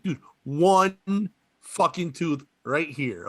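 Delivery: ordinary talk, nothing marked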